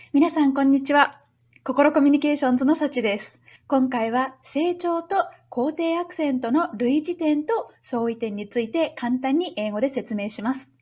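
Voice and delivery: quick, bright self-introduction in Japanese; brisk Japanese greeting. Acted. Teacher greeting Japanese learners, quick and brisk.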